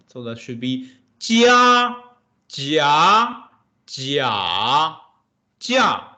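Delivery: jia said in first, second, third, fourth tone; teacher demonstrating tones; four Mandarin tones. Shown as a demonstration. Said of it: "The first tone should be".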